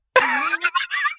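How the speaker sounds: shrieking laugh; laughing in delight; very high laugh burst. Real, not acted. Laughing at the reveal.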